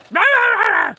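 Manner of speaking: high, loud mock barks; imitated dog barking. Owner imitating dog's last-word barks, loud. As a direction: bark it out like the dog. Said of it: "like that".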